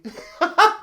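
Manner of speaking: sudden high burst of laughter; breaking into laughter; high squeal laugh. Real, not acted. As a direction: crack up at the bit. Laughs at own bit.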